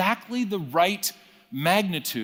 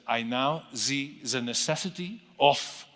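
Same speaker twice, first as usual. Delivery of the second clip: put-on heavy German accent. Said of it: "in his heavy German accent".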